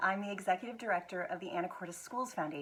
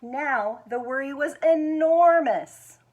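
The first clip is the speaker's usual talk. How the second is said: slow, much louder, swelling on enormous; big dramatic build; 5 semitones higher, 12 dB louder, slower than usual. Acted. Reader showing children how big the worry got, slow and loud. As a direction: make the worry sound huge.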